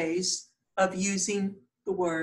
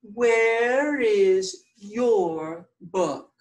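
teacher reading the board slowly, louder; slow, deliberate reading; 2 semitones higher, 4 dB louder, much slower than usual. Shown as a demonstration. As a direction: read "Where is your book?" very slowly. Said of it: "Notice I've underlined the words".

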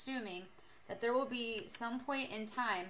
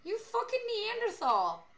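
high-pitched, louder scandalized rant; mock outrage; 12 semitones higher, 6 dB louder than usual. Streamer berates shoeless friend on stream, high mock outrage. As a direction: shriek in mock disgust at someone.

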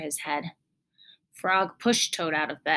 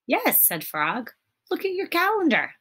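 eager reply; 10 semitones higher, 4 dB louder than usual. A narrator's reading. Frog answering Toad.

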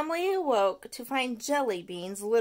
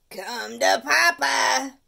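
loud, slow greedy call; greedy shout; 2 semitones higher, 10 dB louder, much slower than usual. Acted. The tooth shouting at incoming cookies, loud and slow. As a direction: gloat over the cookies. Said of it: "shouted the tooth".

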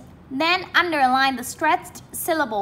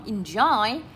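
enjoy with JOY raised; stress on second syllable. Shown as a demonstration. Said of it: "Lên giọng ở âm số hai".

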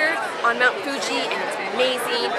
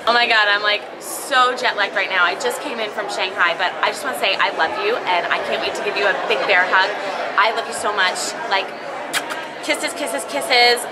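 jet-lagged vlogger; 6 semitones lower than usual. Acted. Parody of jet-lagged gushing vlogger.

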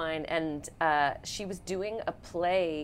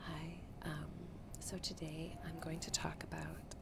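soft close-mic ASMR whisper; 14 dB quieter than usual. Shown as a demonstration. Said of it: "I would like you in your A S M R voice".

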